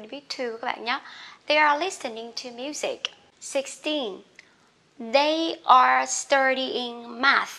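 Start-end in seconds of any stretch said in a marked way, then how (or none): 5.1-7.5 very slow and loud; loud slow read; slow model reading. Shown as a demonstration. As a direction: dictate slowly to the class. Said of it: "slow model read".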